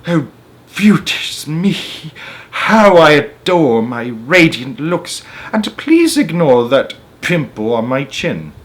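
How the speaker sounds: high, slow, preening aunt voice; vain Aunt Sponge. Acted. Vain aunt admiring herself in the mirror, preening. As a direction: gloat over your own looks in a high voice.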